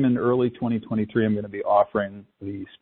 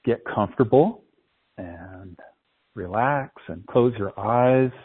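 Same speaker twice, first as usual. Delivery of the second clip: guided meditation. Acted. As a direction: ease them into calm with long pauses. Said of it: "start our guided meditation".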